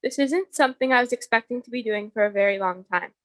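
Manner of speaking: ordinary talk, nothing marked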